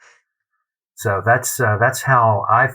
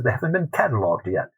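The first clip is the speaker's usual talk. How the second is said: higher-pitched imitation English accent; put-on English accent; 4 semitones higher than usual. Bodleian gentleman explaining a refusal, imitated English accent. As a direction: answer as an Englishman, voice raised in pitch. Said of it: "excuse me for this accent".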